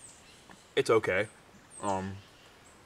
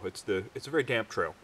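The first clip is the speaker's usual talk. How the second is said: ordinary talk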